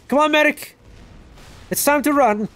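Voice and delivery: high, loud shout to a teammate; calling out. Pretend. Player rallying the medic to run, high shouted call. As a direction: yell it urgently across the battlefield.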